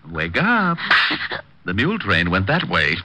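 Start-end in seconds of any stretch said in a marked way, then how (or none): 0.1-0.8 insistent rousing call. Acted. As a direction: Wake up, pitched high and stretched. Rousing him a second time.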